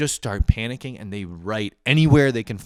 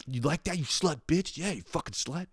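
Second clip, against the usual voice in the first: higher, hammed-up taunting voice; mock dirty talk; 8 semitones higher, 4 dB quieter than usual. To a roommate in the other room: hammed-up higher taunting. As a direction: taunt like a cartoonish creep, pitched up.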